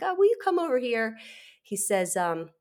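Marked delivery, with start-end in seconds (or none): 0.0-1.2 lightly voiced technician, higher and quick; a hint of a chirpy casual summons; mock-cheery technician calling the patient over; breezy technician. Technician calls her over.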